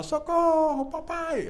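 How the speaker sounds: high, loud mock shriek; cartoon scream for help. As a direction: shriek for help like Olive Oyl. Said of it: "aí gritava". (grito agudo de socorro da Olivia Palito)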